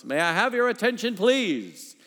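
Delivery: high, booming retold announcement; very loud announcement. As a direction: call out to a whole crowded train. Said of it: "announced in a very loud voice".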